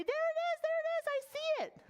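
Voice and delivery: high, bright sing-song shout of discovery; excited call. Shown as a demonstration. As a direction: call it out like you just spotted it. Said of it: "you can say".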